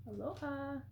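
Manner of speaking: Hawaiian accent attempt. Shown as a demonstration. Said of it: "I probably need to work on my accents".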